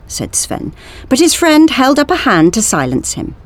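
ordinary delivery, nothing marked

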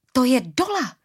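high startled exclamation; startled recognition. A narrator's reading. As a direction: blurt out the sudden realisation. (překvapené zvolání)

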